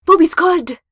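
loud, high, blurted in panic; panicked blurt. Acted. Panicked blurting at half-naked girlfriend.

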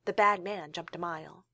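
ordinary delivery, nothing marked

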